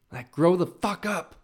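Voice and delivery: fed-up tough-love jab; exasperated. Real, not acted. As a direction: scold them half laughing. Exasperated rant at excuses.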